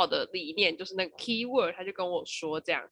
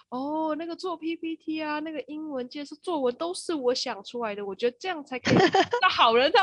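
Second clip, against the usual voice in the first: lightly voiced boss, pitched higher; imitated big boss; 8 semitones higher than usual. Big boss talking to an interview candidate, imitated self-satisfied boss. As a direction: play a boss holding forth, lightly. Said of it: "那个大老板就跟我说".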